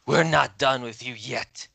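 high, loud call with drawn-out words; battle cry. Acted. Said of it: "the air like".

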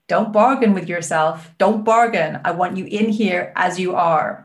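imitated American accent, emphatic; bad American accent. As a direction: order it like an American coach. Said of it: "sorry for a bad American accent".

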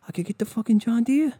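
high, rising on Deere; dudebro brag, rising. Retold or imitated. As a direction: ask it like a giddy offer. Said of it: "these fucking people are like".

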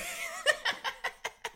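squealing laugh at himself; high laugh. Real, not acted. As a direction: burst into a high giggle. Laughing at own accent attempt.

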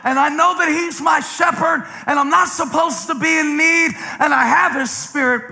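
high, ringing sermon delivery; full-force preacher cadence; preaching. Acted. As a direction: build it up for the congregation.

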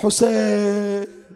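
long, drawn-out call of grief; cry from the heart. A narrator's reading. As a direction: stretch the name out as a mourning cry. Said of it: "وإذا من قلبه صاح".

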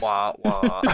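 mock sad trombone. Co-host answers the joke's punchline, mock sad trombone.

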